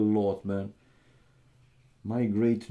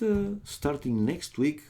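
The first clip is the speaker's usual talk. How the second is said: ordinary talk, nothing marked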